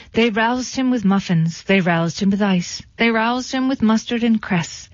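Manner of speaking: ordinary delivery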